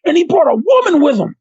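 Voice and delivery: high, excited relaying of gossip; mock a stunned onlooker spreading word; imitated amazement. Classmate reports he brought a date, high mock amazement.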